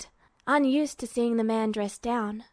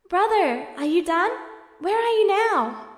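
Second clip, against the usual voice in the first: put-on higher, lighter boyish voice; eager younger brother; 8 semitones higher, 3 dB louder than usual. A narrator's reading. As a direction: sound young and eager on the phone.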